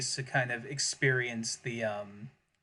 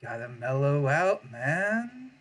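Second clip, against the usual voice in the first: laid-back surfer drawl; slow stoner surfer bro; surfer dude voice. Pretend. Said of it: "It's very slow, very deep".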